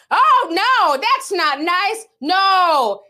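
put-on teacher gasping at the kid; shocked teacher. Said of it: "And they're like".

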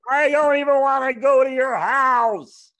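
drawn-out, loud Brad Garrett bit; Brad Garrett impression. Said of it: "that was Brad Garrett".

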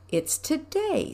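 high, slow little squeak; squeaky Piglet. Acted. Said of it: "squeaked Piglet".

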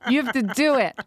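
a hint of a bossy mom, mock insistent; teasing imitation of a mother insisting. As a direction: lightly voice a parent pushing peer pressure. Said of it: "And you're like".

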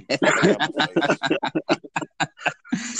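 slow, loud get-out, dragged out; drawn-out mock telling-off; mock yell. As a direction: stretch it out like shooing him off. Retold telling off customer.

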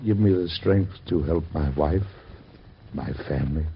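grieving father praying for strength; prayer. Acted. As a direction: beg God for strength. Father's prayer over son.

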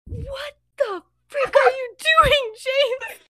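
very high, slow, loud mock screech; hammed-up scandalized cry; shocked outrage. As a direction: draw it out high in disbelief. Lily catches James yelling at dog.

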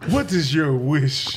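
slow, grand genie voice. Pretend. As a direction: drawl it out like a magic genie. Genie granting wish from water.

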